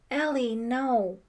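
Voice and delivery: loud, high, drawn-out protest; cried out. A narrator's reading. Brian crying out to Ellie from the doorway. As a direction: call to her in dismay. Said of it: "he cried".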